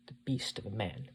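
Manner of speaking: ordinary delivery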